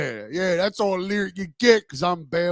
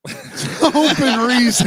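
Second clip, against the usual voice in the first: high, slow words through laughter; real laugh in the voice; laughing repeat; 5 semitones higher, 5 dB louder, much slower than usual. Real, not acted. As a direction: crack up while echoing the joke. Laughing at the acronym joke.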